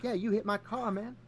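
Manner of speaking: high whiny dude voice; prank recording voice. Retold or imitated. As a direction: whine it like a stoner accusing. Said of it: "He's like".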